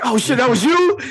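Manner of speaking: high, loud shocked squeal; shocked inmate. Retold or imitated. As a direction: shriek it in disbelief. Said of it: "He's like".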